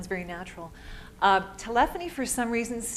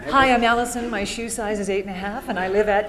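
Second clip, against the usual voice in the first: louder, laughing through a silly fake message; loud, amused parody of a voicemail greeting; mock voicemail greeting; 9 dB louder than usual. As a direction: ham up an oversharing voicemail, giggling.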